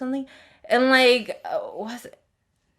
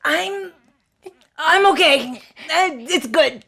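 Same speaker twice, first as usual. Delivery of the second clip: strained high, loud reassurance while choking; 6 semitones higher, 6 dB louder than usual. Retold or imitated. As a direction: act out insisting through a choke. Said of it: "after you're choking".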